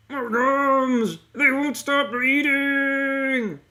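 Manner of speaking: high, loud, drawn-out cartoon wail; over-the-top character shriek, last word stretched; Belgum E O. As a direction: yell it in hammed-up distress. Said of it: "I'll just be doing the voice".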